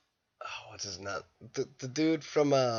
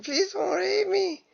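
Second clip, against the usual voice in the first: much higher, slow, hammed-up plea; mock pleading; slower than usual. Secretary begging her boss, mock frightened high plea. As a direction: squeak it out like a panicked secretary.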